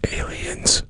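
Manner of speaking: hushed, whispered punchline; mock whisper. Co-host naming what TV shows blame, mock whisper. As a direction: whisper it as if it were a secret.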